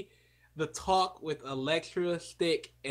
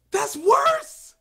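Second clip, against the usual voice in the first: shocked outburst; 10 dB louder than usual. Real, not acted. Shocked outburst over movie opinion.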